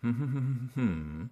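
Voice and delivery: hummed sentence melody, high then falling; humming the intonation. Shown as a demonstration. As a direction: hum the tune of a question without words. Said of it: "I'll have them repeat back".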